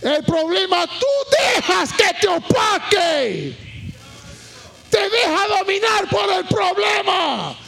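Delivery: high, loud shouted sermon, phrases drawn out at the end; full-force preaching. Real, not acted. Pastor warns the congregation about being dominated by problems, full-force preaching. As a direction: cry it out to a whole congregation.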